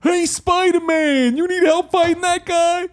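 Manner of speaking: very high, loud shouting crane; crane impression. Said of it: "People want the crane impression".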